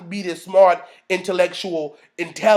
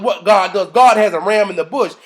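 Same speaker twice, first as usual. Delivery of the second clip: ordinary delivery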